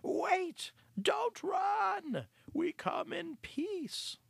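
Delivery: high-pitched, loud and hurried cry; a rat rushing to stop the mice fleeing; hasty high shout. Acted. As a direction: call out urgently in a high squeaky rat voice. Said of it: "hastily uttered".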